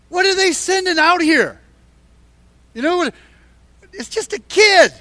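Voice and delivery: high, incredulous giant's outburst; scoffing disbelief, pitched high; insulted Goliath. Retold or imitated. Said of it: "he's kind of insulted".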